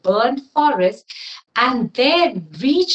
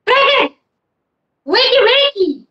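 sing-song wake-up call; 8 semitones higher than usual. Acted. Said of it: "she said".

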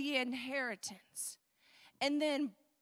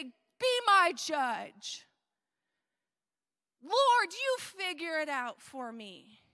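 loud, drawn-out demands; demanding plea; 6 dB louder, slower than usual. Acted. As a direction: call out to God insistently, hammed-up. Acted demanding complaints to God.

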